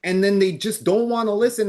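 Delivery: ordinary talk, nothing marked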